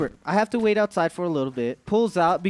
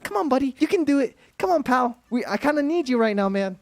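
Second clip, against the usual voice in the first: higher-pitched coaxing pep talk; 6 semitones higher, faster than usual. Retold or imitated. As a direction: urge yourself on, voice raised in pitch. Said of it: "giving myself my own pep talk".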